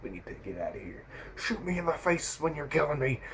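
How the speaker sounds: quiet, strained action-film voice; cliché action-movie hero. Retold or imitated. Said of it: "someone so cliche in an action movie".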